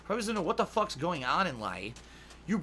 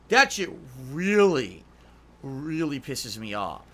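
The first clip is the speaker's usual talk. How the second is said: angry; 4 semitones lower, 5 dB louder, slower than usual. Real, not acted.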